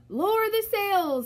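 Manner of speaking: high, urgent shout; storm shout. A narrator's reading. Captain shouting orders in the storm, high and urgent. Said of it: "the Braidbeard shouted".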